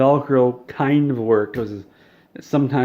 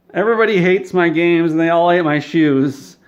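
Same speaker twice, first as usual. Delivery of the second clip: mock whining; 5 semitones higher, 6 dB louder than usual. [name]'s self-pitying complaint.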